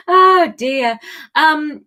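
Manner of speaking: ordinary talk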